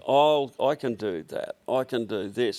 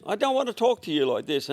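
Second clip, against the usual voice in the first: an offended caller snapping back, much higher; retold angry retort; 9 semitones higher than usual. Caller answering the rude phone clerk, mock angry and high. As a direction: toss it back at him, mock indignant. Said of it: "he said".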